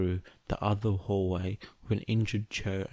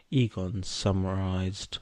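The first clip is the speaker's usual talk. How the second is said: ordinary delivery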